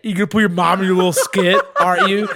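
lightly voiced drunk mother, accusing; nasty drunk mom. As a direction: imitate a wounded, mean drunk mom. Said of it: "nasty drunk like that sometimes".

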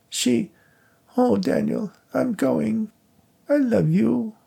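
fake female voice. A narrator's reading. Psychic fakes the dead wife's voice, high falsetto. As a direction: put on a high ghostly wife. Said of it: "his attempt at a female voice".

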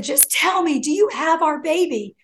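high-pitched, imploring question; retold tearful pleading. Crying friend begging Vic for news, imitated pleading. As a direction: beg for the answer in a high voice. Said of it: "And she's crying".

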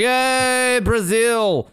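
high, sarcastic crowd cheer; hammed-up hooray for the hosts; mock cheer. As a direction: cheer like a fake-thrilled crowd. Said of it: "And everyone's like".

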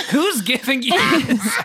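high, incredulous question; mock disbelief. Host retelling her reaction to the assignment, incredulous. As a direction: act baffled at the idea. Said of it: "I'm like".

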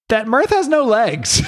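high, drawn-out delivery breaking into laughter; laughing reveal. Real, not acted. Co-host reveals the character's missing legs, laughing. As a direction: stretch it out high, laughing as it lands.

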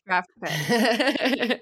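slow, amused, laughing through it; laughing joke. Real, not acted. Co-host teasing about the thirty-fourth pick, laughing. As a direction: tease with a chuckle. Joking about the trade haul.

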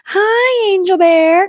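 high, drawn-out cutesy hello; excited pet-name greeting; gushing greeting. Retold or imitated. Acts out greeting her husband.

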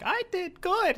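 very high mock little old lady; old mom. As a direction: squeak it out like a proud granny. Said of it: "She's just like".

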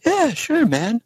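high-pitched acted yes; imitated easygoing agreement. Actor agrees to record an intro, imitated high-pitched yes.

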